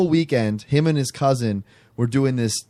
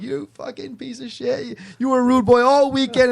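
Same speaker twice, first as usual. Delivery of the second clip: high, imitated group scolding; mock outrage; 11 semitones higher than usual. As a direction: pitch it up as friends' teasing accusation. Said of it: "they're all like".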